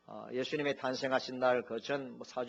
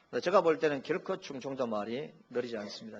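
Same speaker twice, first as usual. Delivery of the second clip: usual speaking voice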